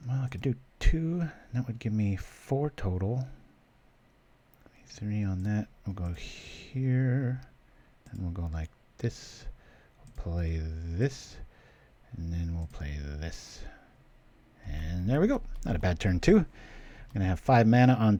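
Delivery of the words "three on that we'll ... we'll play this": soft murmur while placing cards, very slow; whispered card moves. Real, not acted. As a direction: mumble the moves under the breath. Quietly placing cards alone.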